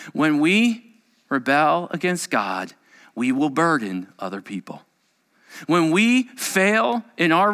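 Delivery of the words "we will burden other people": normal talking voice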